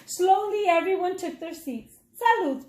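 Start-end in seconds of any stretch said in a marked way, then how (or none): 2.2-2.7 higher, lightly voiced cheery toast; dog's toast. A narrator's reading. Chorizo the dog toasting everyone at the table, high and cheery. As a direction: raise the glass, pitched up and bright. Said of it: "toasted Chorizo".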